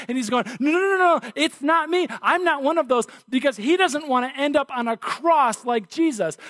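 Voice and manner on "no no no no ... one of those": higher-pitched, hurried mock denial; retold frightened protest, pitched up; afraid. Frightened Peter denying to the little girl that he knows Jesus, afraid. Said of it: "And Peter, he's afraid".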